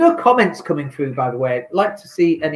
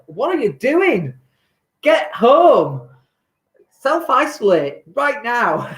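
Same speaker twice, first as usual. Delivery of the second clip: exasperated plea; 6 semitones higher, much slower than usual. Acted. Urging Londoners to go home.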